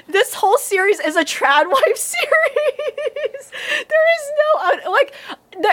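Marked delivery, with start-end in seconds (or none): 1.7-5.7 high, slow words breaking into laughter; laughing. Real, not acted. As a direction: talk through a fit of laughter. Laughing at the premise.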